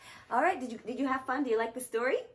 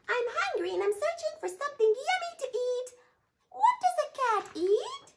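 high, fast squeaky mouse voice; 13 semitones higher than usual. Acted. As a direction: squeak like a nervous little mouse. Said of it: "squeaked Mouse".

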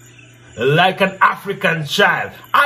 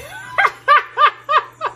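high shrieking laugh. Real, not acted. Riddle teller laughs.

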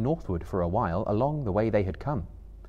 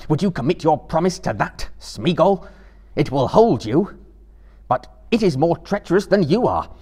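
firm, raised warning to a wretched creature; stern Frodo; 7 semitones higher, 8 dB louder than usual. A narrator's reading. Frodo warning Gollum about the ring, stern and raised. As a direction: warn him gravely, voice lifted.

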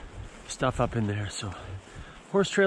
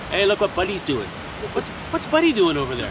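put-on Maritimes accent; 3 semitones higher than usual. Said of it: "where I grew up in the Maritimes".

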